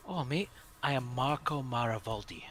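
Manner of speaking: normal talking voice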